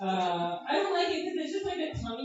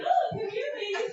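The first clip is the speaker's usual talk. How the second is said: high, sleazy mock call, lightly voiced; creepy pharmacist; 10 semitones higher, 4 dB louder than usual. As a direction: lure the ladies in like a creep.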